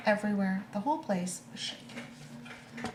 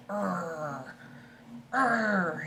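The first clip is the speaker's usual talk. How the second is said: dog growl. Acted. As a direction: growl like a dog acting tough. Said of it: "he's just gonna be growling".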